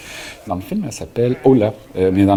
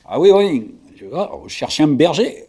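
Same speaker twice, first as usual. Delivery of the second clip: put-on Provençal accent; 6 semitones higher than usual. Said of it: "je reprenais mon accent provençal".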